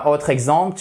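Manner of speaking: ordinary talk, nothing marked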